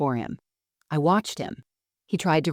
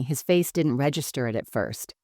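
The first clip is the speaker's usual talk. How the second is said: usual speaking voice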